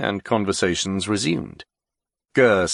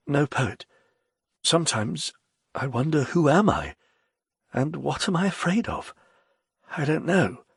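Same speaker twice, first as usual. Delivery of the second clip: put-on higher, troubled old writer; Ger's voice; 7 semitones higher than usual. A narrator's reading. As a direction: voice him wistful and lost. Ger voiced by narrator.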